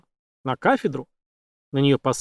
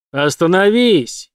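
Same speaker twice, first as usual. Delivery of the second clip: loud, higher, drawn-out cry; frantic shout; 5 semitones higher, 6 dB louder, slower than usual. A narrator's reading. Ford yells at Jane to stop, frantic shout. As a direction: shout it out slowly at full stretch. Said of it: "истошно закричал он так громко".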